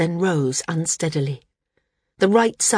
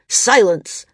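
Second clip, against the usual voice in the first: angry command; 5 semitones higher, 6 dB louder than usual. A narrator's reading. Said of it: "the landsman said".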